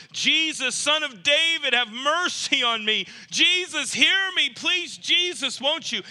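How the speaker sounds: high, loud desperate plea to Jesus; crying out to Jesus. Acted. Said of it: "that other blind man is crying out".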